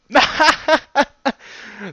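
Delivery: high, loud belly laugh; roaring stage laugh; big acted guffaw. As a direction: double over in hammed-up laughter. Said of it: "doubled over in laughter".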